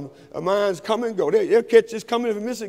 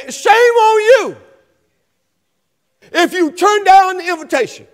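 high, loud, slow preacher's climax; preaching at full force; 7 semitones higher, 5 dB louder, slower than usual. Acted. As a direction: drive each phrase home slowly to the congregation.

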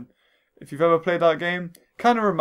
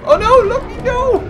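very high, loud wail of dismay; mock distressed cry; 8 dB louder than usual. Shown as a demonstration. Gamer showing what he says while playing, mock distressed cry. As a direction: re-enact a panicked pet owner's yell. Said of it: "I say some stuff".

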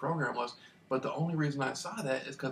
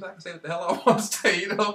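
laughing; 5 semitones higher, 9 dB louder than usual. Retold or imitated. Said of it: "And I'm like".

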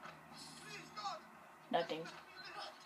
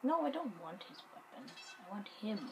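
ordinary talk

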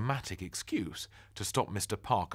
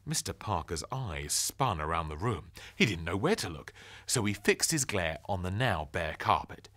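ordinary delivery, nothing marked

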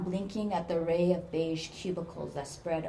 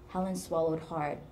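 ordinary delivery, nothing marked

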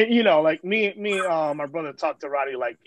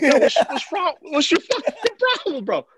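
high, louder retold demand; acted exasperated confrontation; 9 semitones higher, 3 dB louder than usual. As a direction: act out confronting a difficult friend. Acted confrontation with fleeing friend.

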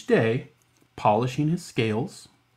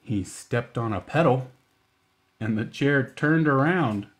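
usual speaking voice

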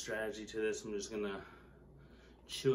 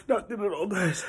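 strained; 10 semitones higher, 9 dB louder than usual. Real, not acted. Strained from pain.

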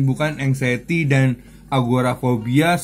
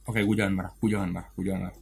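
softer, lower muttered mantra to himself; calming self-talk; 5 semitones lower, 10 dB quieter, much faster than usual. Acted. Driver soothing himself in traffic, quiet mantra. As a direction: quietly talk yourself out of anger.

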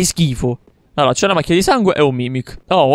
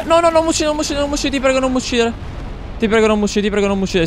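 high mock-desperate plea; panicked begging; 9 semitones higher, much faster than usual. Real, not acted. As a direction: beg for your life. Begging the enemy in-game.

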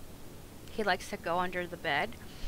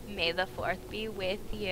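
ordinary talk

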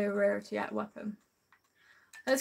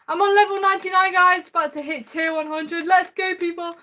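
excited; 7 semitones higher, 4 dB louder than usual. Real, not acted. About to hit tier hundred.

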